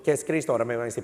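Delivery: ordinary delivery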